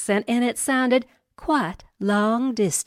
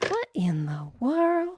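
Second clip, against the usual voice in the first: usual speaking voice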